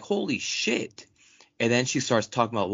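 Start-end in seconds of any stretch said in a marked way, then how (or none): 0.0-1.1 high, slow, breathless disbelief; stunned holy shit. Retold or imitated. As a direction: let it sink in slowly. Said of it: "I'm like".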